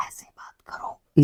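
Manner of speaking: soft breathy whisper, slowly. Shown as a demonstration. Teacher demonstrating a whisper for students, whispering. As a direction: drop to a whisper to show the word. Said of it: "whisper भी आपका थोड़ी थोड़ी आवाज़ में बोलना".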